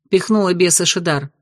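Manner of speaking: plain reading voice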